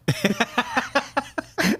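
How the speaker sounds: a quick amused laugh after the story. Real, not acted. Telling a friend a movie line, amused laugh.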